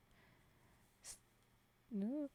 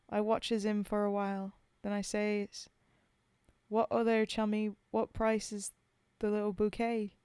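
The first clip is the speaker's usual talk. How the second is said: put-on poem read in a rough British soldier's accent; 5 dB louder than usual. Streamer reading Bill's Grave to chat, in a British soldier's accent. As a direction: tell it as a homesick Tommy at the grave.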